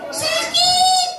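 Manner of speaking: put-on child crying out in pain. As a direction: wail like a hurting little kid.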